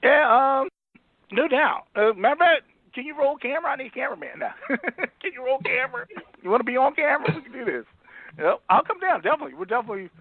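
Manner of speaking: high and eager; excited. Real, not acted. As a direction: jump at the offer. Excited reply to invitation to Philly.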